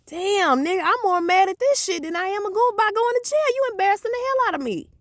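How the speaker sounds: high, whiny embarrassed rant; high embarrassed whine; embarrassed complaint. Retold or imitated.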